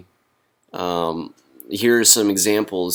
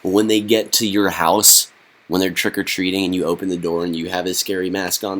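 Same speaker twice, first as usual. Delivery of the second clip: ordinary talk, nothing marked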